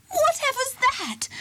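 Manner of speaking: a sudden high-pitched shriek of alarm; screech. A narrator's reading. Traffic warden screeches when something touches her shoulder. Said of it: "screeched Brenda".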